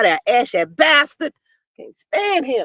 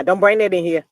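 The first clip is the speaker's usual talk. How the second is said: ordinary delivery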